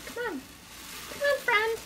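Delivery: very high, pleading little voice; high-pitched coaxing. Real, not acted. Woman urging mouse friend into box, coaxing high. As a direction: coax it like a pet.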